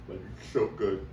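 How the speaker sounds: chewing while saying it, mouth full. Real, not acted. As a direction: talk through a mouthful of food. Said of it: "talking with my food in my mouth".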